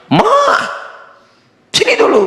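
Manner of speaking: husband calls wife over from the match; husband shouting for his wife across the house; calling out. Retold or imitated.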